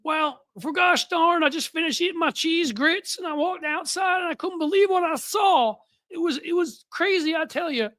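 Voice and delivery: mocking fake Southern accent, pitched very high; John Kennedy. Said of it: "he puts on the fake accent".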